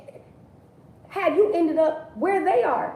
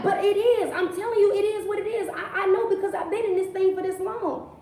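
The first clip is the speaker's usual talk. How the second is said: higher, whining insistence with a laugh; higher mock-whiny complaint; 7 semitones higher than usual. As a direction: play the stubborn sufferer who won't budge. Voicing a stubborn complaining believer.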